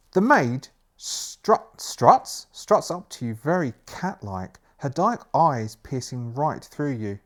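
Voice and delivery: slow, emphatic story reading; gamebook narration. A narrator's reading. Narrator describes the maid approaching, slow dramatic storytelling.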